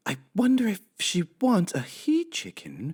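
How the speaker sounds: high housemaid musing to herself; high, sweet Amelia Bedelia voice. Acted. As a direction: play the cheerful literal maid.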